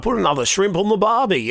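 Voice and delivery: a hint of an Aussie accent; light Australian barbecue cliché. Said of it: "you do the Aussie accent".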